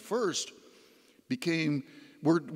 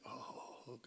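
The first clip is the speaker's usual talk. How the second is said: barely voiced, very soft breath; drowsy murmur; 3 semitones lower, 21 dB quieter than usual. Exhausted patient drifting off to sleep, drowsy murmur. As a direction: act out sinking into sleep, almost no voice.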